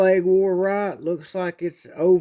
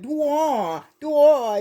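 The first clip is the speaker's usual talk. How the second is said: put-on lisping baby sound; 10 semitones higher than usual. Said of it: "He has that lisp".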